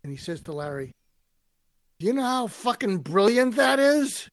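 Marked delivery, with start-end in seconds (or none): 2.0-4.3 loud, high rough New York growl; a hammed-up gruff New Yorker swearing in delight. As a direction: bellow it like an amazed tough New Yorker. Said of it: "a rough, gruff New Yorker".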